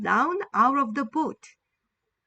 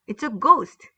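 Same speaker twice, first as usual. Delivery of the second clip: mild scared cry; 7 dB louder, much slower than usual. Acted. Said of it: "and cried out in fear".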